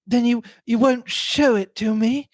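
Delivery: high, halting voice barely held in check; a woman fighting to control herself; desperate self-control. A narrator's reading. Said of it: "a woman desperately controlling herself".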